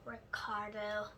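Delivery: quiet, slow, dejected; sad. Acted. Junie sadly tells her teacher the trouble. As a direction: let his name droop with sorrow. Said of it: "I said real sad".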